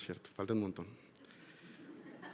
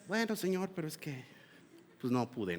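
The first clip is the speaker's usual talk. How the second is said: a soft, low, embarrassed mumble; sheepish excuse; 3 semitones lower, 11 dB quieter than usual. Retold or imitated. Worshipper excusing himself to the Lord, soft sheepish mumble. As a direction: trail off, ashamed of failing. The same worshipper two days later.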